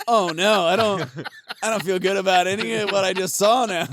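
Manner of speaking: loud, high, hammed-up horror; overacted shame at what he just saw; mock dismay. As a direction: ham up the regret, loud and high. Acting out a disgusted viewer.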